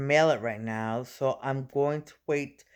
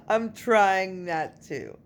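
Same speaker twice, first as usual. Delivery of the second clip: slow, higher, through a laugh; 5 semitones higher, 3 dB louder, slower than usual. Real, not acted. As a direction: repeat it laughing at yourself. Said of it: "at least I'm trying not to".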